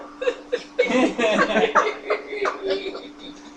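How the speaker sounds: high chuckle, drawn out; long amused laugh. Real, not acted. Speaker keeps laughing at the baby's diaper, long real laugh. Baby in the room.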